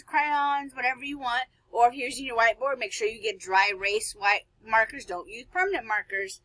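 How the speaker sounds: normal talking voice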